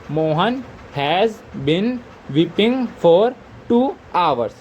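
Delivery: very slow, drawn-out words; patient classroom dictation. Shown as a demonstration. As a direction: spell it out for the students. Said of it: "teacher dictating finished sentence".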